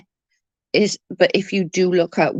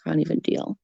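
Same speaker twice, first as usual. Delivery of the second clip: hushed, deflated, much softer; despairing whisper; 8 dB quieter than usual. Retold or imitated. Missing her dead dog, a defeated whisper. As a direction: barely breathe it out in grief. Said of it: "now him not being here is just like".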